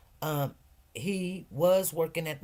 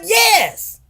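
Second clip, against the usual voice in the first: shouted yes; 14 dB louder than usual. Retold or imitated. Shouted agreement.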